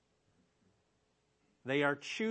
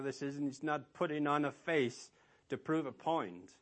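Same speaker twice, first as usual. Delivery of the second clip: normal talking voice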